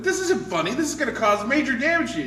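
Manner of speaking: high, loud acted warning; loud protest. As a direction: raise your voice over their laughter. Joke character protests at laughter.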